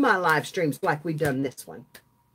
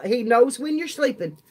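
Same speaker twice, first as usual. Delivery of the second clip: laughing; 6 semitones higher than usual. Real, not acted. Joking about Santa song lyrics.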